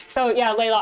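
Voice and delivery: put-on, jokey British accent; bad British accent. Streamer addressing viewer Layla, bad British accent. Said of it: "throw some bad British accent at y'all".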